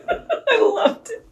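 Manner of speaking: high, drawn-out, through laughter; giddy laughing squeal; laughing delight. Real, not acted. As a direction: gush it while giggling. Laughing after her own bad impression.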